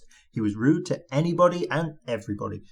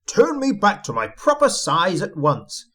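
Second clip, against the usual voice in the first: higher-pitched indignant demand; spluttering rage; 7 semitones higher, 4 dB louder than usual. A narrator's reading. Shrunken Mister Uppity ordering the goblin, raging splutter. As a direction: splutter it crossly. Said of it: "spluttered Mister Uppity in a rage".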